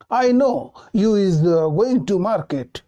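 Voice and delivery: slow, deliberate faulty English; slow wrong sentence. Shown as a demonstration. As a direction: say it slowly, mistake and all. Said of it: "क्या गलत बोला".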